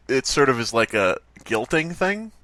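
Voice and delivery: ordinary talk, nothing marked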